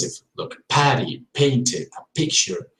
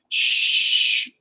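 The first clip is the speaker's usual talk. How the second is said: long whispered shh; hushing shh. Shown as a demonstration. Teacher showing the sh sound. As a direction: hush someone to silence. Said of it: "this is the sound".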